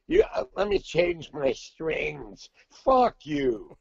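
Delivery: high, slow whiny mock of a guitarist. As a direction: sneer it like the spoiled guitar player. Mocking the guitar player's attitude.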